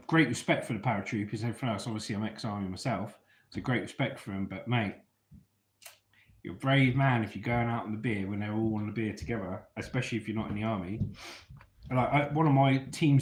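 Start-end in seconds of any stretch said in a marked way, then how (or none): none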